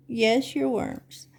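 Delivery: ordinary delivery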